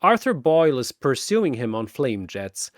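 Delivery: ordinary delivery, nothing marked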